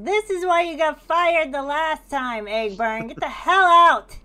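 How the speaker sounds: full-force high angry shout; enraged yelling, very loud; furious yell. Acted. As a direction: bellow it across the bar, throwing someone out. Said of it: "And Sam just yells".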